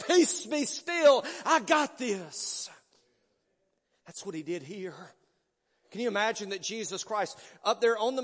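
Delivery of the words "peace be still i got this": slow, gentle comfort in the Lord's voice; calm reassurance. Retold or imitated. Preacher voices Jesus calming him in the storm, slow and reassuring. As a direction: soothe like embracing a frightened child. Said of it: "the Prince of Peace embraces me and says".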